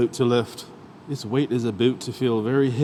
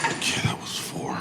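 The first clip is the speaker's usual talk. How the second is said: winded whisper after the lift; breathless whisper; 5 dB quieter than usual. Real, not acted. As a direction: gasp it out, spent. Exhausted after heavy set.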